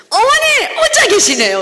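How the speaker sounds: put-on honeyed call to the old bosal; sweet loud greeting. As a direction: greet her brightly and sweetly. Bright greeting to lone bosal.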